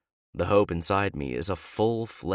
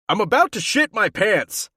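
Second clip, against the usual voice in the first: much higher, much louder desperate yell; Ben yelling; 9 dB louder than usual. A narrator's reading. Teammate yells into the bathroom, desperate and loud. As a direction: yell it through the door. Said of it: "Ben yells into the bathroom".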